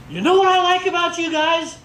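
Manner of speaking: high holler to a group; yelled call. Shown as a demonstration. Leader to Cub Scouts at a pack meeting, yelling. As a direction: call it out high to rile up the boys. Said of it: "I'm gonna say".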